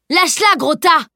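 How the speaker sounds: high, forceful order; threatening shout. A narrator's reading. Friend defending the grabbed girl, threatening shout. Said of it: "menaça Salim".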